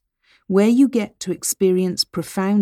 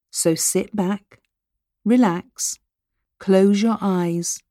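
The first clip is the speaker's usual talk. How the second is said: right in your ear; slower than usual. Acted. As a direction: ease them down, unhurried. Start of hypnosis taster.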